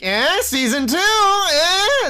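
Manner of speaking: cheesy knowing nudge, last eh jumping high; mock wink to camera. Streamer parodying the video's fourth-wall wink, cheesy and high. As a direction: ham it up like a wink at the audience. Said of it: "like, wink to the camera".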